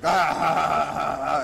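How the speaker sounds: loud hammed-up villain laugh; evil laugh. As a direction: laugh like two gloating demons. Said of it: "they both are like".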